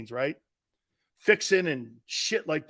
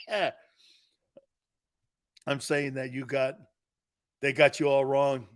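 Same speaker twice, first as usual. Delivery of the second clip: ordinary delivery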